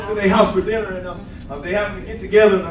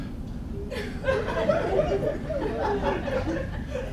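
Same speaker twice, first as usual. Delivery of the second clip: long high laugh breaking through; high laughter; 11 dB quieter than usual. Real, not acted. Laughing while retelling the prayer.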